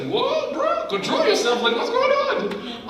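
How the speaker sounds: a friend shocked at the crying, laughing a little; startled friend. Retold or imitated. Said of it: "my friend Steven was like".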